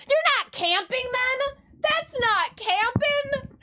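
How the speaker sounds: high, slow, teasing outrage; huffy camping purist; mock indignant. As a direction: scold them for the hot shower, playfully. Indignant mock retort, laughing.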